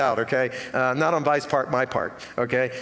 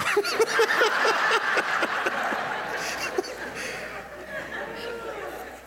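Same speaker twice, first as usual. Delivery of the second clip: drawn-out high laughter; high long laugh; 4 dB quieter than usual. Real, not acted. Long laugh at own joke.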